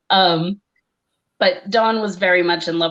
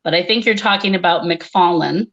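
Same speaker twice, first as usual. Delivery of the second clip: ordinary delivery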